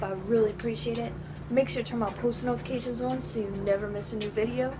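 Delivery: fast, rehearsed sign-off read; vlog outro. Real, not acted. Vlogger signing off to viewers in a fast, practiced outro.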